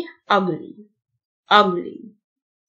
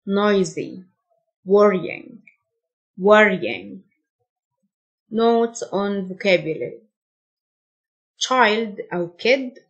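usual speaking voice

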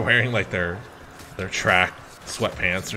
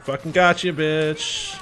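louder, higher jeer; mock gloating; 8 semitones higher, 5 dB louder, slower than usual. Friends taunting him after the prank, gloating loudly. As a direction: crow over a prank that worked.

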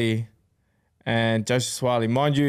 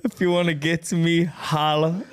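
jokey American voicemail voice through laughter; mock American accent; 4 semitones higher than usual. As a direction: imitate his American-accented sign-off, cracking up. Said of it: "he talks in an American accent".

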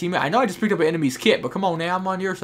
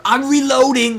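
higher, louder shouted "I'm reloading!"; mock call-out; 8 semitones higher, 8 dB louder, slower than usual. Mocking Battlefield's soldier voice lines, loud yell. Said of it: "why are we yelling that shit".

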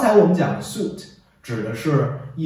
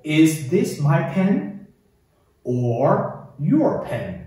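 very slow teacher's model reading; 领读示范; 3 semitones higher, much slower than usual. Shown as a demonstration. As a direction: spell out the question word by word for learners to follow. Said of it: "跟我一起读". (慢速逐词领读问句)

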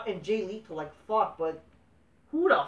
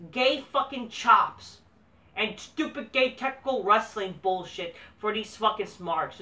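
ordinary talk, nothing marked

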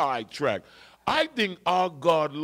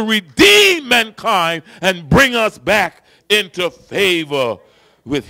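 loud, higher, building sermon crescendo; rally the congregation to rejoice; preaching at full force; 9 semitones higher, 12 dB louder than usual. Acted. Preacher climbs again to redemption.

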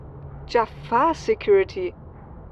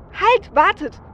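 high, loud call to halt; urgent warning; 9 semitones higher, 5 dB louder, slower than usual. Acted. Lookout stopping the team before the lab, urgently. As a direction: call out sharply to stop them.

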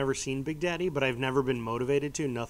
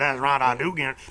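put-on Cajun accent; 5 dB louder than usual. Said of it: "I just imitated the a-, assistant coach".

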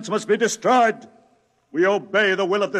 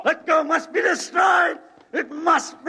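fanatic shout; 9 semitones higher than usual. Acted. Cultist shouting at the scientists while grappling, frantic yell. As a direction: scream it while wrestling for the plates. Cultist struggling over the plates.

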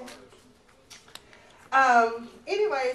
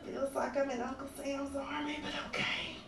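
weary mutter; 4 semitones higher, 9 dB quieter than usual. Retold or imitated. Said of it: "I'm just like".

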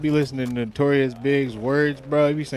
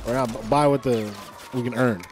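normal talking voice

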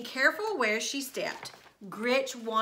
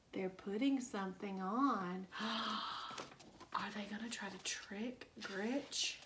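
slow whispered wonder; hushed, wide-eyed whisper to little listeners; suspenseful whisper; 12 dB quieter than usual. Real, not acted.

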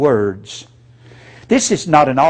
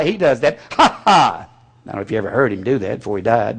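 usual speaking voice

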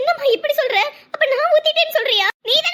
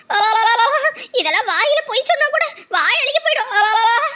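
wailing lament. Acted. Wailing lament, beating chest.

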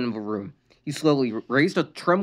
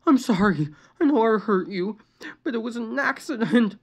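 high, tearful plea; pleading; 11 semitones higher than usual. A narrator's reading. Sister pleading with her brother, tearful. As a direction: plead to calm him. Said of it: "Luann pleaded".

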